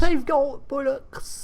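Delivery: high, slow fake British accent; mock British accent. Host answers in [name]'s fake British accent. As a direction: ham up a British twang. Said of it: "she talks in a British accent".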